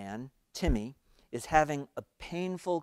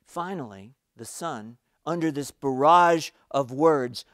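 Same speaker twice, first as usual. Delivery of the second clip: ordinary talk, nothing marked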